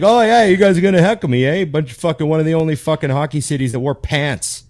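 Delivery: comic on stage mocking a hostile crowd, taunting; Bill Burr taunting the crowd. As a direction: sneer at the hecklers as Bill Burr. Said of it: "He's like".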